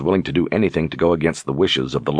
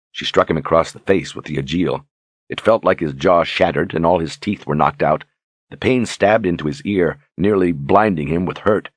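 ordinary delivery, nothing marked

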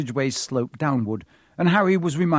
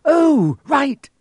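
sudden realisation; 13 semitones higher, 9 dB louder than usual. Acted. Hermione suddenly remembering.

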